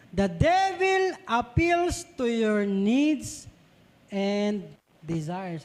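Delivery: drawn-out quote, word by word; very slow reading. Shown as a demonstration. Preacher reading the key line to congregation, very slow. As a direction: read the line very slowly, weighing it. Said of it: "sabi din sa devil schemes".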